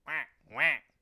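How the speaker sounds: loud imitated quacking; duck quack. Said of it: "quacked the duck".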